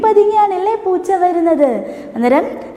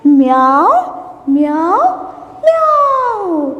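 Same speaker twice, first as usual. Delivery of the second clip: cat meow; much slower than usual. Shown as a demonstration. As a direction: mimic a kitten crying for children. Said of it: "എങ്ങനെയാ പൂച്ച കരയുന്നേ?".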